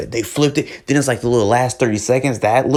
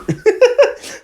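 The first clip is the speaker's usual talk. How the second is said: loud high burst of laughter; 5 dB louder than usual. Real, not acted. Laughs after lyric.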